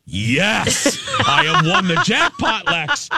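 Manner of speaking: over-the-top gleeful celebration; jubilant yell. Retold or imitated. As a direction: whoop it out in triumph. Acting out his elation at winning.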